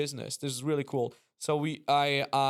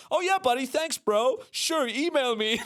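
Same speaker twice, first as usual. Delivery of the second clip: much higher, casual dismissive chirp; breezy brush-off; 9 semitones higher than usual. Retold or imitated. Speaker brushing off the famous headliner backstage, breezy and high. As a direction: wave off a stranger you think is staff. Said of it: "I was like".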